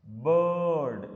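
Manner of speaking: बर्ड with the er vowel drawn out very long; stretched vowel. Shown as a demonstration. Teacher to students, stretching the vowel of बर्ड. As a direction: hold the vowel in बर्ड, slow and steady. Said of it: "अ को थोड़ा सा stretch करते हुए बोलना है".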